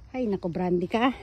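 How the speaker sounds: ordinary talk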